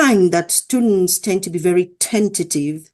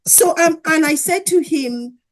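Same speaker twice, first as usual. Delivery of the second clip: ordinary talk, nothing marked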